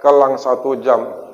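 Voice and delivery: ordinary delivery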